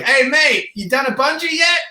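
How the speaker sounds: high-pitched mock Kiwi accent; rough New Zealand accent. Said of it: "my accent was off".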